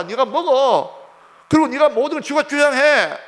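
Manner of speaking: high, loud goading tempter; devil egging someone on. Acted. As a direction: play the devil urging someone to demand their rights. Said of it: "마귀 역사 뭡니까?".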